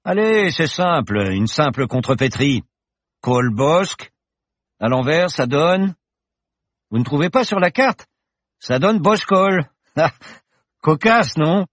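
the confident pilot professor, merry; cheerful professor. Acted. Professor reassures Paloma in the plane, jaunty and loud. Bergton explains the anagram.

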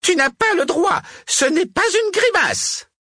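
very high, loud witch's shriek; furious protest. A narrator's reading. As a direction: screech your objection in full rage. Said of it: "verte de rage".

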